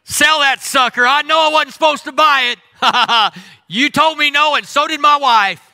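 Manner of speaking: high, loud mock ad and plea; hammed-up sales pitch prayer. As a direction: bark the boat for sale at God.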